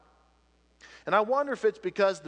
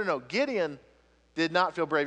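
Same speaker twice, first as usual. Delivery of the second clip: ordinary talk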